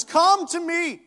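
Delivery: high, loud, slow command; commanding call. Acted. Jesus commanding the congregation, slow loud call. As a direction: order them to come. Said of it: "he commands us".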